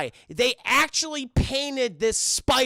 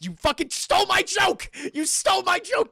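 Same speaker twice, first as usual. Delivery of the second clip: higher, louder mock outrage; indignant outburst; 10 semitones higher, 4 dB louder than usual. Real, not acted. Streamer yelling at a chat viewer who stole his joke, playful outrage. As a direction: protest loudly at the chat.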